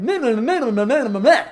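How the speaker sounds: loud sing-song nonsense mimicry; mock the complainers with babbled mehs; jeering imitation of whiners; mocking whining. Mocks people complaining and cancelling.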